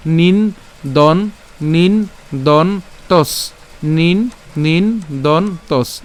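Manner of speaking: slowly separated "নিন, দন, তস"; syllable by syllable. Shown as a demonstration. Teacher building the word for learners, slow separate syllables "নিন দন তস". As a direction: pause after each of নিন, দন, তস. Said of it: "আমরা উচ্চারণ করলাম, তাহলে".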